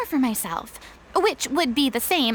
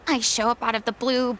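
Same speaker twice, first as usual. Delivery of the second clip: ordinary talk, nothing marked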